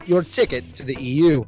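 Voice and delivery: ordinary talk, nothing marked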